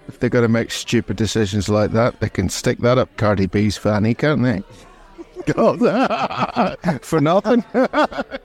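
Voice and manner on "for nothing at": laughing. Real, not acted. Laughing at own joke.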